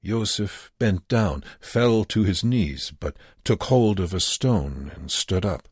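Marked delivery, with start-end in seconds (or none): none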